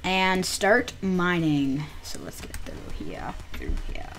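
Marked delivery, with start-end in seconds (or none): 2.0-4.1 breathy sneaking whisper. Real, not acted. Whispering while sneaking through.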